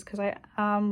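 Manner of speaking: ordinary delivery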